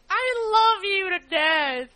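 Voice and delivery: gushing. Real, not acted. Gushing over a listener email.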